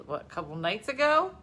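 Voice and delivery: usual speaking voice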